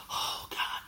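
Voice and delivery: breathy exasperated whisper; whispered oh God. Real, not acted. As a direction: let it out as a whisper. Weary aside after annoyance.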